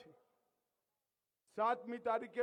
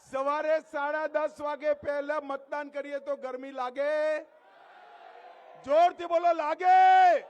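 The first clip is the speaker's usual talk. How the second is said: high, very loud calls to the crowd; rally shouting; 4 semitones higher, 12 dB louder than usual. Real, not acted. Rally speaker rousing crowd to answer. (સભામાં જોરથી બૂમો પાડીને પૂછવું)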